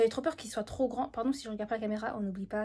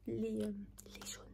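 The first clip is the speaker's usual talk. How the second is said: soft whisper, keeping it hushed; hushed unboxing whisper; 9 dB quieter than usual. Real, not acted. Whispering her haul so nobody wakes up.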